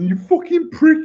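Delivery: high, loud "you fucking prick"; mock crying yell. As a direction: yell it like a hurt kid. Crying kid yelling at brother.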